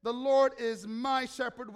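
slow, loud line with "my" stressed. Shown as a demonstration. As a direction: declare it slowly, leaning on "my". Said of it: "I want you to emphasize the word my".

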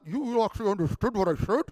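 slurred, muffled amazement; swollen-lip speech. Retold or imitated. Speaker baffled that the audience asks questions, swollen-lip speech. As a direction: marvel through a fat lip, words mushed together. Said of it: "Like that".

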